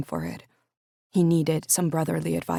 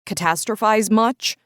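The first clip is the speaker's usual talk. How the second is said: higher, louder teasing quip; sarcastic jab; 5 semitones higher, 6 dB louder than usual. Acted. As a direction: needle your brother with a smirk. Tanner let out a snort.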